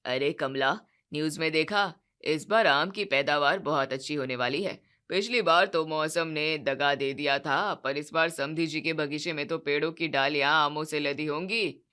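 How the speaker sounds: low, pleased expectant voice; greedy father-in-law. Acted. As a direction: Sharma ji telling Kamla about the harvest news. Sharma ji hopeful again.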